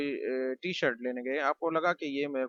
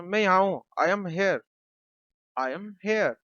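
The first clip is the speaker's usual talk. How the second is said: high, louder reply from another room; calling back; 5 semitones higher, 5 dB louder, slower than usual. Acted. Raju answering the family member calling him. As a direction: call back so they can hear.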